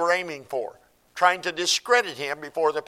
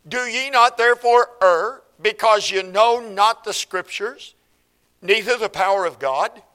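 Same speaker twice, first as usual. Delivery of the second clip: higher, louder preached scripture; preaching at full force; 4 semitones higher, 4 dB louder than usual. Acted. Preacher voicing Jesus rebuking the Sadducees, forceful. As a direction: proclaim it with force.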